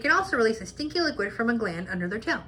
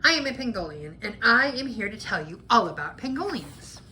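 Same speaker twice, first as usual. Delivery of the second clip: plain reading voice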